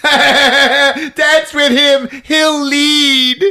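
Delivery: high, loud, drawn-out cry; hammed-up villainess egging them on; mock gleeful command. Mom's voice acted in film recap.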